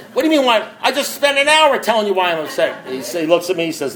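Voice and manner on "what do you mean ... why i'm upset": high-pitched indignant comeback; acted exasperation. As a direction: protest as if nobody has been listening. Retold exasperated answer to sponsor.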